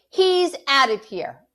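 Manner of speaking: loud, high, furious declaration; angry. A narrator's reading. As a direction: lay down the law in anger. Angry mother orders dog gone.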